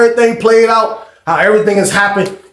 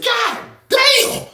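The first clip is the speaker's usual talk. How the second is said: very high, drawn-out god damn; stunned exclamation; 4 dB quieter than usual. Retold or imitated. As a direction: gasp it out in awe. Reacting to kick.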